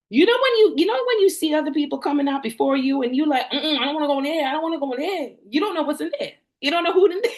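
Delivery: loud, high and fast; high excited rant. Real, not acted. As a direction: spill it out, worked up.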